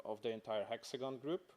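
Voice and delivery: ordinary talk, nothing marked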